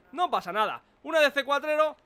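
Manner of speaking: normal talking voice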